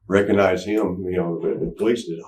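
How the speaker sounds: ordinary talk, nothing marked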